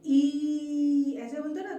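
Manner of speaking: छोटी ई: a quick light "ई" with the voice lifting up; "ई" said short and light, with the neck going up; short ee demo. Shown as a demonstration. Teacher shows the short ee to young students, lifting the voice. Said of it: "जब हम छोटी ई बोलते हैं तो".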